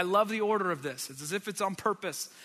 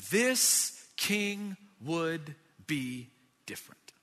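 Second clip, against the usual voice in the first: slow refrain; 2 semitones lower, much slower than usual. Acted. Repeated refrain, slowed.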